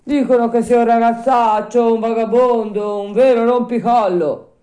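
put-on high, loud old man's denunciation; emphatic old man's voice; disapproving old man. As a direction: reel off the insults as a villager. Old man denounces Pinocchio.

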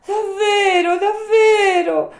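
very high, loud puppet squeal; slow, jubilant high shout; overjoyed cry. Acted. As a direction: cry out for joy, stretching the words. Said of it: "gridò il burattino saltando dall'allegrezza".

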